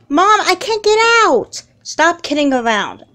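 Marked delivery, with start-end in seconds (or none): 0.1-1.6 high, loud, desperate cry; terrified shout. Acted. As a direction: scream that you're trapped. Trapped in flooding room.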